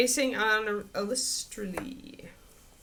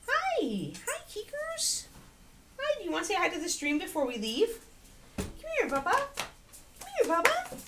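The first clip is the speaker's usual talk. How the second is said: squeaky dog talk; 14 semitones higher than usual. Real, not acted. Talking to her dog.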